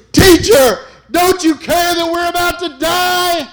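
screaming high and loud in terror; terrified disciples shouting at sleeping teacher; panicked shout. Retold or imitated. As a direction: cry out as the boat sinks. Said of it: "they said to him".

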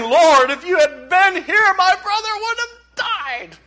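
grief-stricken plea. Acted. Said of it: "she can't even talk".

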